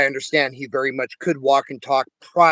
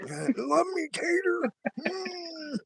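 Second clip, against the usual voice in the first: silly high voice; 8 dB quieter, much slower than usual. Pretend. Mimicking friend's silly voice.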